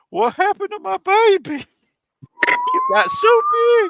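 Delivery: very high, loud falsetto mom voice; mock mom squeal. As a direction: squeal like an amazed mother. Mom voiced seeing grown son.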